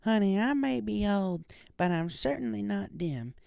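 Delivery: put-on a kindly old grandma teasing gently; Granny. A narrator's reading. Granny voiced in story.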